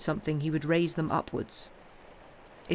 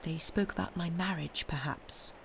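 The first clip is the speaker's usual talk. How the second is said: hushed, confidential suggestion; soft, trailing into a near whisper; lowered voice; 5 dB quieter than usual. A narrator's reading. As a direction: lean in and confide it quietly. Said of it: "lowering her voice and coming close".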